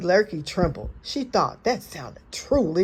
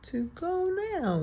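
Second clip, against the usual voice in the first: sly fox; 4 dB quieter, much slower than usual. A narrator's reading. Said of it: "said Foxy Loxy".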